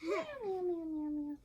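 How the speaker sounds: cat meows. Pretend. Reading to a child, cats chatting on the phone, playful meows.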